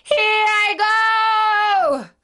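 very high, loud, drawn-out scream; wailing witch going under; dying witch's shriek. Acted. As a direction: shriek your last as you melt away. Witch's last cry as she melts.

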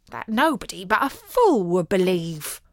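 plain reading voice

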